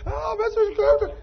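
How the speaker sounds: high, slow, whiny falsetto of a hurt woman; mock offended woman. Preacher mimicking women at work calling him Scrooge, high whiny falsetto. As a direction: ham up the girls teasing him as a grump. Women mocking his harshness.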